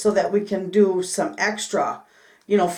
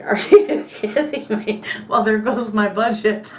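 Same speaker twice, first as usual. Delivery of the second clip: laughing disbelief. Real, not acted. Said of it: "It's like".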